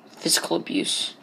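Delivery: low whispered joke. Pretend. Gamer whispering a joke about his unplanted potatoes. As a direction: breathe it low as a dark aside.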